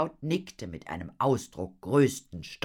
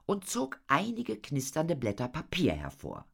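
plain reading voice

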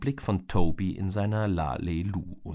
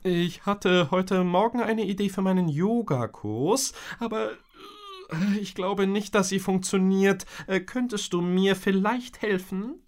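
higher, strained embarrassed mother; strained mom; 11 semitones higher, 3 dB louder than usual. A narrator's reading. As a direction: Mom knotted up asking for help. (gepresste, verlegene Mutterstimme)

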